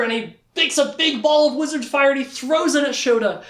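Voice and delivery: high-pitched action-scene storytelling; excited narration. A narrator's reading. Teller describes wizard's fire attack, excited. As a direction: tell it like the big climax.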